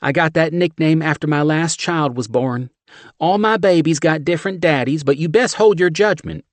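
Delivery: high, loud brassy Southern woman; thick Southern accent. A narrator's reading. Steph shoving chili at John, thick Southern accent. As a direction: push the chili on him, Southern.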